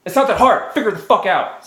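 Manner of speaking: high, loud angry snap; angry outburst. Real, not acted. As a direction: explode at the studio in frustration. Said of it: "Sorry, I'm mad".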